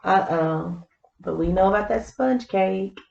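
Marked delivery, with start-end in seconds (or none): none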